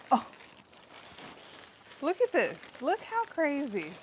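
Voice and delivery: loud, high burst of amazement; excited amazement. Real, not acted. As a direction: show it off, thrilled. Showing off huge greens harvest.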